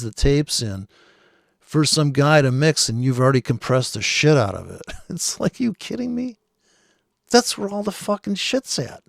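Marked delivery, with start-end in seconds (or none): 5.1-9.0 incredulous. Acted. Mocking disbelief at over-compression.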